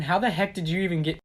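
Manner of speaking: ordinary talk, nothing marked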